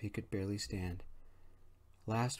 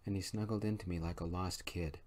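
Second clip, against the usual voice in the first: usual speaking voice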